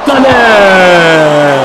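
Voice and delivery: loud, drawn-out cry; sudden shout. Real, not acted. Gamer shouting as the keeper saves, loud cry. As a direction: shout at the save. Shootout save moment.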